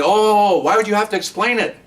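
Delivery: high, mocking "Oh, why would you have to explain it?"; sarcastic faux-innocent question; mock incredulous. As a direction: parody the clueless critic. Mocking the officer's critics.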